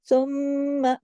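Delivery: सुम्मा with a long hummed म्म; big nasal hold. Shown as a demonstration. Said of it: "आप इधर भी बड़ा गुन्ना करेंगी". (सुम्मा, बड़े गुन्ने के साथ)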